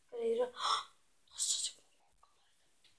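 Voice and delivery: hushed, catch breath on finding it; whisper with a gasp. Real, not acted. Girl whispers, gasping as she finds her flower shirt. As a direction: whisper with a small gasp of discovery. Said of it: "puhun näin hiljaa".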